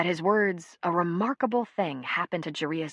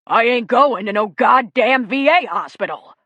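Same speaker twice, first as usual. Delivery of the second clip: loud gruff bark at the visitors; 5 semitones higher, 11 dB louder than usual. A narrator's reading. Clarence barking at the nurse from the V A. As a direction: snap it out, much louder, hostile. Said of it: "Clarence barked".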